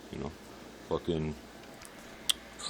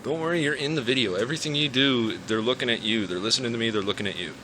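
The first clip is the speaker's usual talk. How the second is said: higher pet-talk voice; talking to the dog; 4 semitones higher than usual. Real, not acted. Talking to his dog while smoking, high pet voice. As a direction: coax the dog while lighting up.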